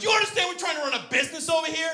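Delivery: high, rapid indignant outburst; pissed off. Retold or imitated. Marie telling off the prank caller, pissed off. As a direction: imitate an irate woman, fast and high. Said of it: "Marie got pissed".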